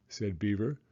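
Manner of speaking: plain reading voice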